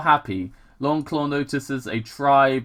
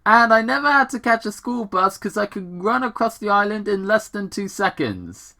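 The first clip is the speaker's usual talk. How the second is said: put-on higher, excited kid hedgehog voice; young Sonic; 9 semitones higher than usual. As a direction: bounce it up high like a cocky kid. Voicing young Sonic in retelling.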